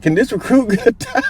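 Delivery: a giggling recruit barely asking; high laughing plea. Retold or imitated. Recruit asking the sergeant for a towel, high and laughing.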